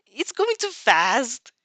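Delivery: whining. Acted. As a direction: complain like it's unfair. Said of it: "you whined".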